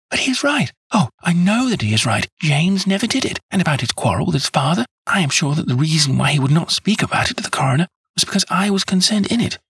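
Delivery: put-on high, eager plea from a devoted young woman; earnest young woman. A narrator's reading. Miss Turner begging Holmes to clear James, eager and high. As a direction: insist on his innocence, breathless with hope.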